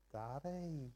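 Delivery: soft, sweet asking voice, a little put on; wheedling daughter. Preacher imitating his daughter about to ask for something, soft and wheedling. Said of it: "that that's going to cost me".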